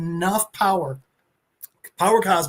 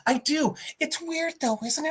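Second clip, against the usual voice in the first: higher, softer, offhand; casual Ghost Rider reply; 8 semitones higher, 5 dB quieter than usual. Retold or imitated. Ghost Rider answering Thanos, higher and softer. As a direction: shrug it off lightly. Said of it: "Cosmic Ghost Rider goes".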